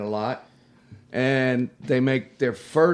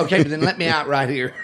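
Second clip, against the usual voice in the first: quick, louder line broken by laughter; laughing through it; 3 semitones higher, 6 dB louder, faster than usual. Retold or imitated. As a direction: tell the girl's line while laughing fast. Voicing girl while laughing.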